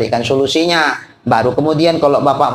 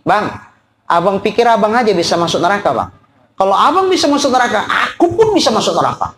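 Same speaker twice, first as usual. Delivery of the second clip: defiant tit-for-tat wife; 5 semitones higher than usual. Acted. Wife retorting to her cheating husband, high and fast defiance. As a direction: snap back at your husband, pitch climbing. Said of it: "Itu kan sama dengan gini".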